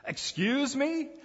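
high, slow "excuse me?" in mock shock; mock disbelief. Preacher voicing a baffled healed man, mock surprise. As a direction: play the stunned listener, drawn out. Preacher reacts to Jesus' request.

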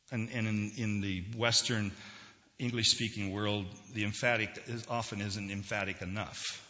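usual speaking voice

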